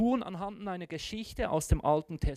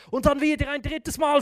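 ordinary talk

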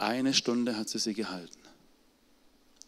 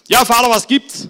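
hoch und laut, fragend; Sohn am Telefon; 11 semitones higher, 15 dB louder than usual. Retold or imitated. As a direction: wie ein verwunderter Sohn am Hörer. (Sohn Alfons fragt laut und verwundert nach)

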